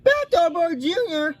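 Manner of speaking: groaned. Acted. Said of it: "groaned the others".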